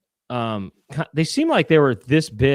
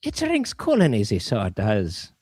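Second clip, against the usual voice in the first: Irish accent; 8 semitones lower than usual. Shown as a demonstration. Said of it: "trying to sound Irish".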